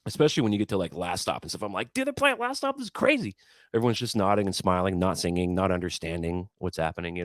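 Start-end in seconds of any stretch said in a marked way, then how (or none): 1.9-3.3 very high excited squeal; thrilled fan. Retold or imitated. Fan at the rail as the band plays Last Stop, thrilled. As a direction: gush like a starstruck fan.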